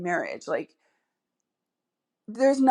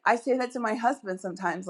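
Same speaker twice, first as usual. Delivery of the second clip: ordinary talk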